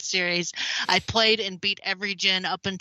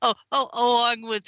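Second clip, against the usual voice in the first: ordinary talk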